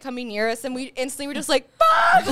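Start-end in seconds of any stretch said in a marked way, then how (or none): 1.8-2.3 high, loud yelp through laughter; mock-terrified shriek, re-enacting the scare; retold panicked yell. Retold panic as dog approaches.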